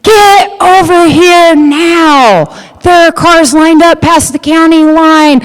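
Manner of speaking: high, loud, urgent call; frantic brother. Retold or imitated. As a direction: panic over the phone, get here now. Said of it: "He says".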